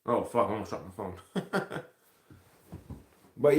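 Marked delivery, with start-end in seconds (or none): none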